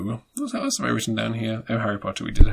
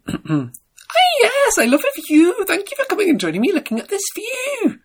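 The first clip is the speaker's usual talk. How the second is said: very high, breathy-free squeaky Scottish TV host; Lorraine Kelly impression. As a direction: squeak it out like a chirpy breakfast presenter. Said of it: "I'll do my impression".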